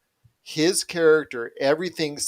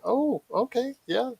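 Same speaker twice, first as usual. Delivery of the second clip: lightly imitated reaction, pitched up high; mild surprise; 10 semitones higher, slower than usual. Host recalls hearing the actor's real accent, mildly surprised.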